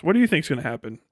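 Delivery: ordinary delivery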